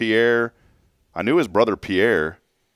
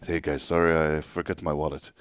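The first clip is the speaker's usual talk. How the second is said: put-on soft, sheepish French accent; imitating the apology once more in a French accent; 5 semitones lower, 7 dB quieter than usual. Imitating Tony's dad forgetting his wallet again, French accent. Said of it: "he has a very distinct accent".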